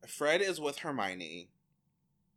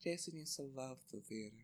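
wistful aside; 12 dB quieter, faster than usual. Retold or imitated. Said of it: "Cheryl says".

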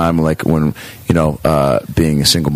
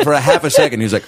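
ordinary talk

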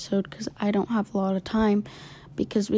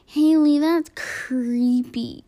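friends teasing; 5 semitones higher, 5 dB louder, much slower than usual. Retold or imitated. Friends voiced in a story.

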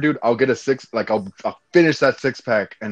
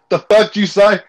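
mock angry bigot; 8 semitones higher, 6 dB louder than usual. Mocking a hostile northerner.

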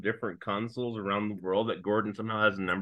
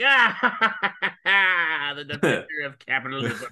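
evil villain laugh; 3 semitones higher, 4 dB louder than usual. Retold or imitated. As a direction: cackle then sneer like a Soviet villain. Imagined Russian villain laugh.

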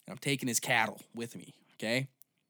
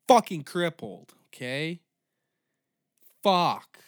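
loud, much higher frustrated shout; frustrated yell; 6 semitones higher, 14 dB louder, slower than usual. Real, not acted. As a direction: bellow the complaint. Complains about crippled ankle.

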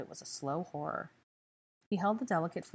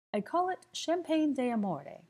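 showy flourish; 9 semitones higher, 3 dB louder than usual. A narrator's reading. Theo names his creation.

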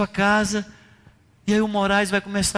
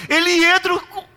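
full-force preaching; 8 semitones higher than usual. Acted. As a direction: build it up like a revival preacher.

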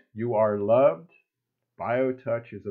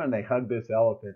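normal talking voice

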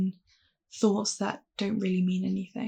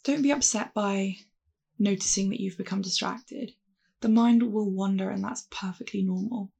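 calm, unhurried meditation voice; guided meditation. Acted. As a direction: guide them gently through the breath. Leads a mindfulness meditation.

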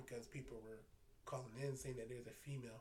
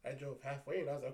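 ordinary talk, nothing marked

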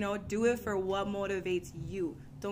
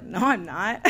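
louder flat refusal; 8 dB louder, slower than usual. Retold or imitated. Refusing to walk at the older girl's pace, firm. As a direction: shut it down firmly.

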